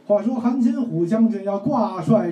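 measured storyteller recital in standard Mandarin; 普通话评书; Mandarin pingshu demo. Shown as a demonstration. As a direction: declaim it like a proper storyteller. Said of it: "我跟我哥哥是这么说的".

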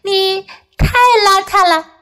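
high scolding cry; 小狗高声责备; Puppy crying out. A narrator's reading. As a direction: scold him in a high little voice. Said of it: "波比叫了起来".

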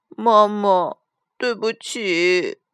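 slow child's whimper, acted; tearful apology. A narrator's reading. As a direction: apologise like a little girl in tears. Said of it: "阿丽哭着说". (带着哭腔慢慢道歉)

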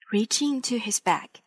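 plain reading voice

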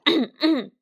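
a loud, deliberate throat clear; pompous throat clearing. A narrator's reading. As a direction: clear the throat before a speech. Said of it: "赶紧清了清嗓子".

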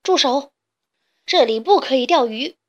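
loud, bossy order to stop; loud shouting. A narrator's reading. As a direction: shout a firm ban. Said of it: "它大声嚷嚷".

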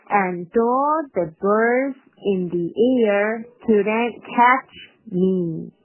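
slow reading leaning on "birds", "air" and "catch"; stress on BIRDS, AIR, CATCH. Shown as a demonstration. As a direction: draw it out and punch "catch". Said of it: "那这面的重读呢有". (慢读，重读birds、air、catch)

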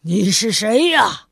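loud, flustered demand; exasperated demand. Acted. Mr. Fussy confronting the intruder in his garden, loud exasperated demand. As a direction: bark it at the stranger who wrecked your gate. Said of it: "气急败坏地说".

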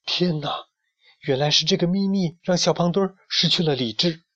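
high, amazed exclamation; astonished realization. A narrator's reading. Hidden friend sees the treasure, astonished. Hidden watcher discovers the secret.

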